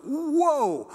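a foodie blown away by one bite; amazed whoa. Retold or imitated. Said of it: "and go".

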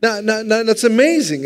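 high, bright-eyed amazement; excited. Real, not acted. Preacher marvels aloud to the congregation, excited. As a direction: lift it up in wonder.

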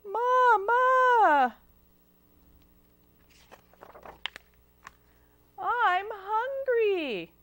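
high, drawn-out hungry wail; crying little girl. Acted. Hungry girl calling to her mother, high crying whine. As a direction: whine and cry like a small child. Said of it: "cried the little girl".

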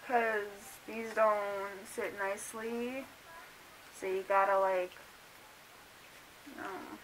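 muffled behind leather mask; speaking while adjusting mask; through a face mask. Real, not acted.

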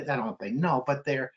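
ordinary talk, nothing marked

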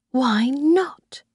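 whispered to herself. A narrator's reading. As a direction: breathe the question without voice. Narrator's inner question.

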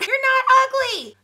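high, loud insistence; emphatic protest. Acted. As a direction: insist on it in mock outrage. Protesting negative self-talk.

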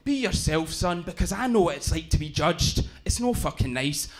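rough Glaswegian uncle giving earnest advice; Glaswegian accent. Retold or imitated. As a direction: imitate a Glasgow uncle counselling his nephew. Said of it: "like, the roughest of them all".

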